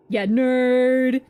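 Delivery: loud, drawn-out teasing jab; mocking jeer. Real, not acted. Streamer mocks the nerdy doctor on screen, loud teasing. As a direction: sneer it out at him.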